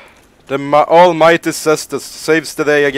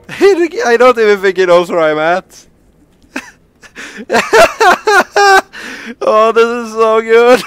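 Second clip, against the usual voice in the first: loud, high, cracking up; delighted and helpless with laughter; laughing delight; 10 semitones higher, 8 dB louder than usual. Real, not acted. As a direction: say it through real gleeful laughter. Cracking up at the game.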